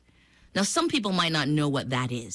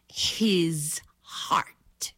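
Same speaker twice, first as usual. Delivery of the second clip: whispered. A narrator's reading. Secret crocodile wish told.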